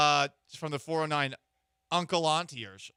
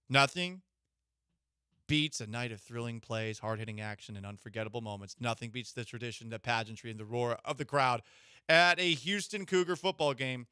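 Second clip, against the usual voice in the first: ad read; 4 semitones lower than usual. Acted. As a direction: read it like a radio spot. Ad read for tickets.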